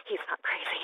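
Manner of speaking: soft, secretive whisper; whispering an aside that Crazy Earl isn't crazy. Acted. Defensive aside about Crazy Earl.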